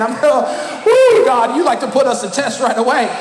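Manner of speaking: normal talking voice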